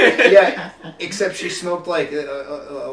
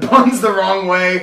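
normal talking voice

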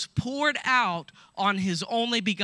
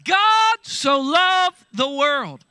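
joyful; 6 semitones higher, 9 dB louder, slower than usual. Shown as a demonstration. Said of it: "What am I doing this morning".

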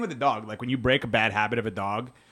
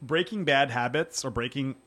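ordinary talk

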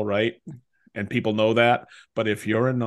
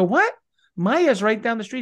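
higher, startled disbelief at the neighbor; incredulous what; 10 semitones higher, 3 dB louder than usual. Retold or imitated. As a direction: throw back a stunned what. Speaker's own retold reaction.

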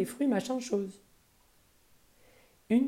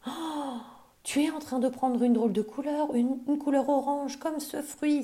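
higher, stunned and stammering; astonished cry; 8 semitones higher than usual. A narrator's reading. Mother sees Polly turning orange, astonished. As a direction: gasp it out in disbelief. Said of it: "s'écria sa mère".

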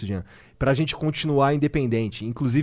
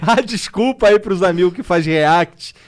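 laughing; 3 semitones higher, 6 dB louder, slower than usual. Real, not acted. Laughing apology to react channels.

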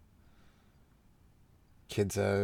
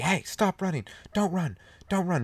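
mom yelling; 9 semitones higher than usual. Retold or imitated. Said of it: "she's like".

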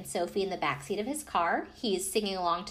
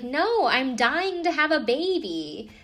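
slow, higher, louder punchline; playful reveal; 6 semitones higher, 5 dB louder, slower than usual. Retold or imitated. Wife revealing she wants a baby, slow playful punchline. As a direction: savor the twist. Sister answering with joke.

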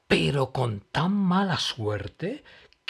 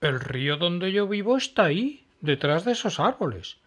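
eager crocodile; 8 semitones higher, 6 dB louder than usual. Acted. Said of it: "dijo Pillo el cocodrilo".

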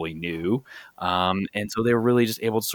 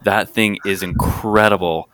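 louder, gushing over the car; excited gushing; 8 dB louder than usual. Retold or imitated. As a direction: rave about the Marauder, loud and eager. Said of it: "You came over and you're like".

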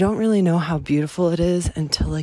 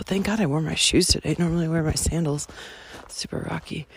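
ordinary delivery

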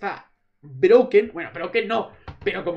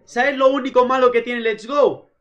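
excited outburst; 6 semitones higher, 8 dB louder than usual. Real, not acted. Heated defence of the game.

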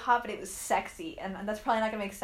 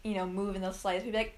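normal talking voice

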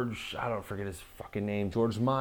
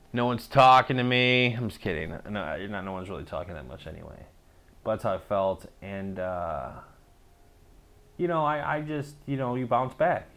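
ordinary talk

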